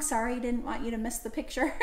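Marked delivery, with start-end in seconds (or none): none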